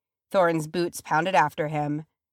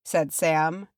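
plain reading voice

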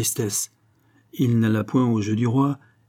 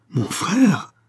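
higher, drawn-out cry of dread; horrified cry; 8 semitones higher, 4 dB louder than usual. A narrator's reading. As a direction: cry out in shocked horror. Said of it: "saisi d'épouvante et d'horreur". (cri horrifié)

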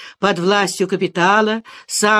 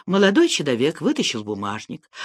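plain reading voice

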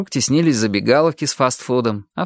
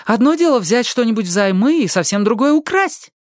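high-pitched moral objection; insistent; 10 semitones higher than usual. A narrator's reading. Ali argues borrowing is not stealing, insistent. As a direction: keep pressing, high and emphatic. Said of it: "настаивала Али".

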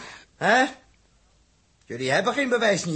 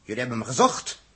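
normal talking voice

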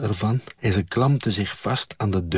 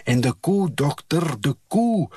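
raised-pitch anxious farmer's call; 7 semitones higher than usual. A narrator's reading. Farmer at the stable calls after the doctor, protesting. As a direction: call after someone leaving, rising higher. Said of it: "riep Westwij bij de stal".

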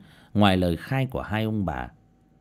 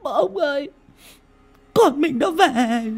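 put-on much higher, slow tearful old woman; tearful old mother; 5 dB louder, slower than usual. Mother telling her husband their daughter came back, tearful and high. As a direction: tell him through tears. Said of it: "Bà cụ Lan bật khóc".

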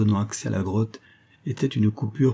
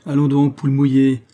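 mocking; 5 semitones higher, 7 dB louder than usual. Convict mocks frightened partner.

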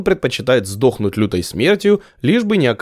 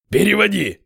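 growled; 9 semitones higher than usual. Acted. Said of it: "прорычал Костоправ".

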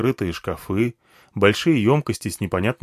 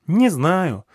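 put-on high child voice, brushing it off; offhand childish reply, pitched up; absent-minded little girl; 8 semitones higher, 3 dB louder than usual. A narrator's reading. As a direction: dismiss it like a small girl busy with something else. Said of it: "рассеянно отмахнулась Нагма".

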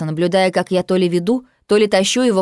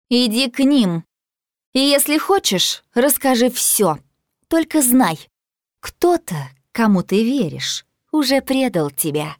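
Nicasia voice; 5 semitones higher than usual. A narrator's reading.

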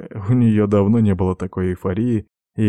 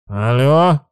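higher, louder fake phone hello; disguised pinched-nose voice; 4 semitones higher, 5 dB louder than usual. A narrator's reading. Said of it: "зажал пальцами нос, ответил не своим голосом".

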